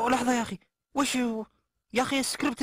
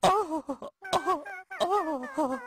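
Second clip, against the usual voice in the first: mock sobbing; 7 semitones higher, much slower than usual. Crying before begging forgiveness.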